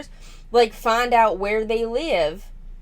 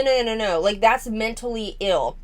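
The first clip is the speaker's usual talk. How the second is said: normal talking voice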